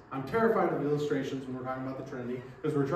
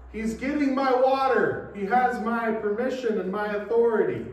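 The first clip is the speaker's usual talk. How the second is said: calling out louder and higher from the office; 6 semitones higher, 5 dB louder than usual. Retold or imitated. Pastor calling from his office to the stranger, calling out. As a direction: shout it across the room. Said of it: "and I call out".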